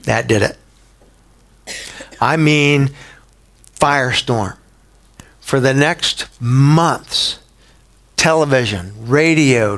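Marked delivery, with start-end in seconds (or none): none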